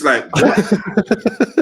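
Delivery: slow, drawn-out laughing what; laughing disbelief. Real, not acted. Friend cracking up at the absurd court story, drawn-out laughing disbelief. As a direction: stretch it out in amused disbelief. Baffled punchline about the stab wound.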